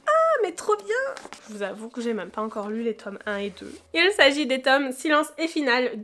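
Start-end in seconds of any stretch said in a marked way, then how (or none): 0.1-1.3 excited. Real, not acted. Delighted reaction to a parcel.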